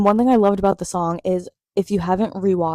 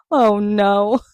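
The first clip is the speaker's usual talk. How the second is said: louder, imitated Oh no in a put-on voice; imitating Shane; 2 semitones higher, 5 dB louder than usual. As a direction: mimic the caught-out Oh no. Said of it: "The way he's like".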